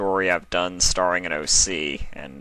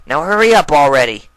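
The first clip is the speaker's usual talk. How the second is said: higher and louder, impatient; impatient colt; 8 semitones higher, 10 dB louder than usual. Acted. Colt rushing his sister into the forest, loud and impatient. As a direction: hurry her along.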